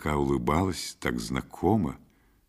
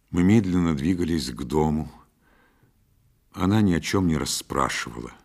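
usual speaking voice

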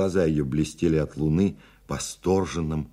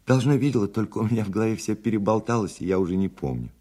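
put-on higher, lightly hurried girl's voice; thin girlish voice; 6 semitones higher, 3 dB louder, faster than usual. A narrator's reading. Girl answers a stranger about a film, in a thin high voice. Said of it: "рассказывала тоненьким, тоже розовым голосом".

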